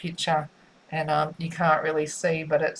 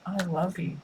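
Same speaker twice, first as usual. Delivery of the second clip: soft loving murmur; 6 dB quieter than usual. Acted. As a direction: drop to a soft, intimate I love you. Repeating a channeled love message.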